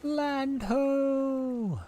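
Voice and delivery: high sailor's cry of land ho; shouting from crow's nest. Pretend. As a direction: call it out like a lookout on the mast. Boat reaches land.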